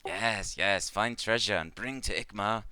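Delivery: lightly voiced insect-like trader in broken English; a gentle creature trader, halting and simple; Clicknid merchant. The GM voicing Ikmar to the adventurers, lightly halting.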